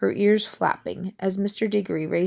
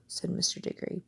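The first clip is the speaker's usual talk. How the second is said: ordinary delivery, nothing marked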